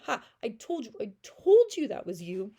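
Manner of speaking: loud gloating shout; triumphant Javert. Retold or imitated. Javert catches Valjean, loud triumphant gloat. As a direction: crow over the caught convict. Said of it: "He's very triumphant".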